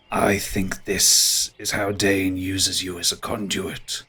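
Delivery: ponderous, musing ent; ancient tree creature. Acted. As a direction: recall it slowly, like an old tree.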